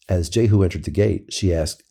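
plain reading voice

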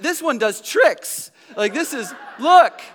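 high, loud, hammed-up delight; dad mock-cheerfully showing off a dying fish; mock excited. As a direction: pretend to be thrilled, showing the kids. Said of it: "I'm like".

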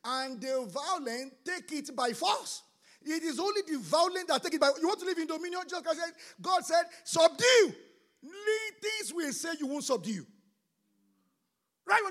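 loud, driving sermon voice; fiery preaching. Acted. Pastor urging the church to violent faith, fiery preaching. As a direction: preach it hard to the congregation.

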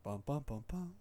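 low startup jingle hum; Windows impression. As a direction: hum a low computer chime. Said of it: "give me a Windows".

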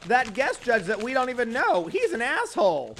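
high, loud mocking comic voice; mock bad comedian.

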